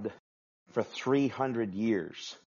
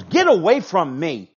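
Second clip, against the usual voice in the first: loud, higher, disgusted dismissal; angry God; 6 semitones higher, 12 dB louder than usual. Acted. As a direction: push them away with your voice. God rejecting the proud.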